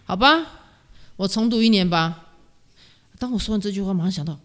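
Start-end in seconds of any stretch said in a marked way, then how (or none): 0.1-2.3 re-enacted loud, higher-pitched announcement to mum; raised voice, acting out giving in; calling out loudly. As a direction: call it out as if to mum from the bath. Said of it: "我就大声地跟我妈说".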